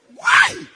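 a sudden piercing high scream, very loud; startled scream. Retold or imitated. Wife waking up to a thief, piercing scream. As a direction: shriek as if jolted from sleep.

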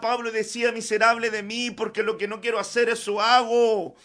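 loud, ringing pulpit voice; preaching at full force. Acted. Preacher urging the church to depend on the Lord, full force. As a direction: preach it out over the music.

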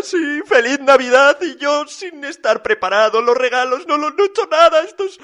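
high, whining, breathless plea; panicked Papá Noel. A narrator's reading. Papá Noel with no presents ready begging for help, high panic. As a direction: spiral into helpless pleading.

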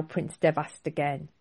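usual speaking voice

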